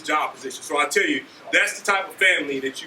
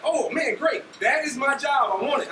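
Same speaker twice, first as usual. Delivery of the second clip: high-pitched, retold delight; replayed eagerness, voice up high; excited; 8 semitones higher than usual. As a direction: act out a thrilled, instantly sold recruit. Acts out being won over.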